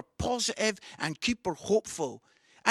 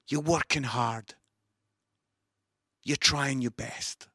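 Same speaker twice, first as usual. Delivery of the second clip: low, gentle words with long pauses; tender pastoral appeal; 4 semitones lower than usual. Real, not acted. Preacher speaking to struggling viewers' souls, low tender appeal.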